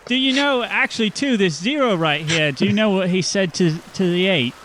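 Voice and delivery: lightly voiced teacher joke; joking teacher. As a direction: tell it like a kid's joke.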